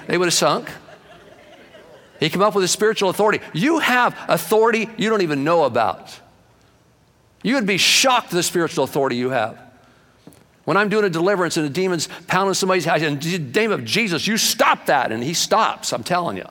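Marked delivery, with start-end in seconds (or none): 2.2-13.0 fiery preaching. Acted. Preaching at full force. 13.0-15.1 commanding demon. Retold or imitated.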